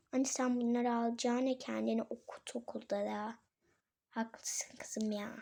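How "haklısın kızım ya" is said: slow, soft whisper; whispered concession. Pretend. Mother conceding to her daughter in a slow whisper. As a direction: give in with a sheepish whisper.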